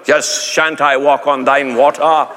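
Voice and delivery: slow pompous olde English; mock archaic Peter. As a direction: ham up fake Bible speak. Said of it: "you're not going".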